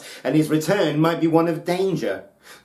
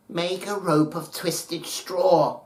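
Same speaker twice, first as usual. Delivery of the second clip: put-on 1 old mother's instruction; 6 semitones higher, slower than usual. A narrator's reading. Mother instructs her son.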